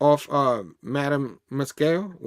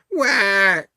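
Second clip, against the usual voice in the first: short, loud, high fake sob; hammed-up cartoon bawl; mock crying wail; 5 semitones higher, 6 dB louder than usual. Comic reader imitates Rick Rapp's crying, mock wail. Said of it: "Rick Rapp is crying".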